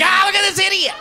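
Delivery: high, loud jeer; mocking onlooker. Bystander watching him chase a cart, loud jeering laugh. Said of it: "Someone sees you like".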